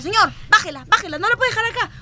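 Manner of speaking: high-pitched insistent mock yell; retold shouting. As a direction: imitate an outraged passenger calling to the fare collector. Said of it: "el señor empezó a gritar".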